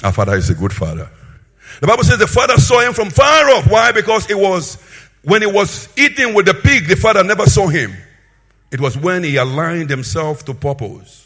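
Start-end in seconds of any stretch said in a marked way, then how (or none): 1.8-8.0 high, loud pulpit proclamation; full-force preaching. Real, not acted. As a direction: preach it out with a raised, ringing shout.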